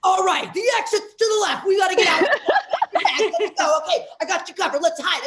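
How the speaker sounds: mock military commands; army commander. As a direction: take charge like a soldier on a mission. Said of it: "It's like I'm in the army".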